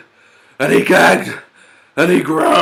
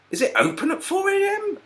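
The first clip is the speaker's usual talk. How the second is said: much higher, disbelieving question; incredulous; 4 dB quieter than usual. Acted. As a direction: ask it in utter disbelief. Pig incredulous.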